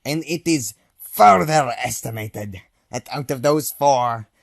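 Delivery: ordinary talk, nothing marked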